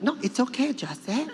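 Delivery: put-on high-pitched accented grandmother; Lola Tinay. Grandmother brushes off her grandson's objection, high and insistent. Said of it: "She's like".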